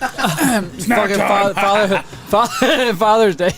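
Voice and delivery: stumbling through laughter; can't get the words out laughing. Real, not acted. Cracking up mid-word.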